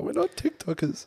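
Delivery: high laughing protest; laughing denial. Retold or imitated. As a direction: laugh it off in disbelief. Denying being TikTokers, laughing.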